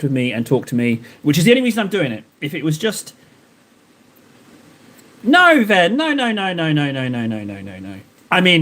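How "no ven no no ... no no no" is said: trailing off no; fading refusal. Real, not acted. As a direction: talk yourself down from a firm no to a mumble. Pleading refusal fading away.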